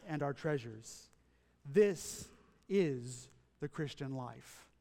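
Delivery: low, soft and slow, fading at line ends; hushed reflective preaching. Acted. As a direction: let each phrase sink in quietly. Soft reflective sermon close.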